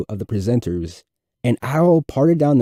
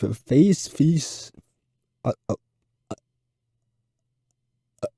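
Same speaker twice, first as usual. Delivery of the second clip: heavy stutter. A narrator's reading. Nervous fourth grader stuttering through his book report to class. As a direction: fight through each repeated sound. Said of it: "relieved that his presentation was over".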